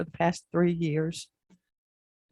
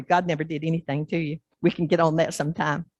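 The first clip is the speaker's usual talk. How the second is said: ordinary talk, nothing marked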